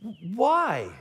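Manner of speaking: drawn-out baffled question in a put-on voice; acted incredulous questioner; mock bafflement. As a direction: react as a puzzled onlooker.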